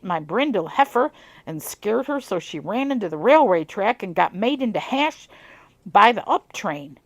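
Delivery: put-on amateur southern accent on an old farmer's tale; southern twang. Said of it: "a bit of a southern twang".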